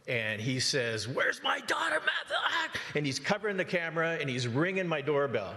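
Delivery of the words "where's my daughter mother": high, yelled demand for his daughter; furious shout. Retold or imitated. Angry stranger at the gate, shouting for his daughter. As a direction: bellow it like a man gone ballistic. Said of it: "goes ballistic".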